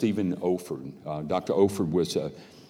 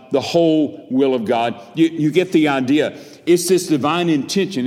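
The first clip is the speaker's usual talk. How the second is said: higher, louder pulpit delivery; forceful preaching; 6 semitones higher, 7 dB louder than usual. Real, not acted. Preacher expounding the whole counsel of God, forceful preaching. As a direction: drive each phrase home to the congregation.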